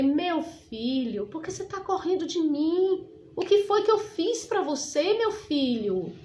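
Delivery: drawn-out opening, rising into a high appeal; imploring, pitch climbing then falling away; plaintive motherly plea. Retold or imitated. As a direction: reenact a hurt mother pleading. Retold plea to runaway young man.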